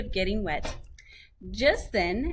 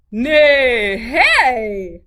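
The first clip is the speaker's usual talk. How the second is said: loud neigh in words; whinny it out; horse neighing; 3 semitones higher, 11 dB louder than usual. A narrator's reading. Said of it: "said the horse".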